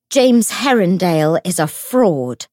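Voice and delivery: loud, hoarse accusing cry. A narrator's reading. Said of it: "she cried in a hoarse voice".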